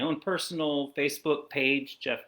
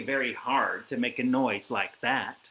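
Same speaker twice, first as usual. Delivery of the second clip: usual speaking voice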